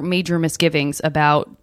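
ordinary talk

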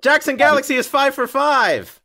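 high, loud game-show celebration; excited announcement. Real, not acted. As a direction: announce a perfect score in triumph. Host announces a perfect round.

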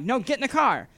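high, loud repeated demand; insistent order. Retold or imitated. Stranger insists he get in, loud and pushy. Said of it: "And like".

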